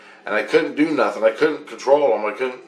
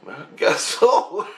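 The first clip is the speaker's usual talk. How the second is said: slow, higher reply with a laugh; laughing concession; 8 semitones higher, slower than usual. Retold or imitated. Dreamer giving in to Christ, laughing reluctantly.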